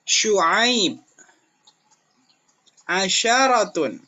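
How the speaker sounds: loud, careful word-by-word reading; example words. Shown as a demonstration. Teacher reads example words for pupils, careful sh and s. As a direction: read each example word with crisp sh and s. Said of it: "di sini ada contoh".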